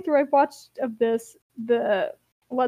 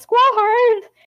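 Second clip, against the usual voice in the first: much higher, loud alarmed call; 11 semitones higher, 8 dB louder than usual. Retold or imitated. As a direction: shout her name to warn her.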